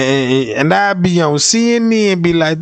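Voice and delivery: ordinary talk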